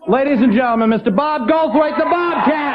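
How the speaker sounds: rousing MC build to the name; stage announcer. Real, not acted. Host brings the next comic on stage, building announcer's call. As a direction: voice climbing into a big introduction.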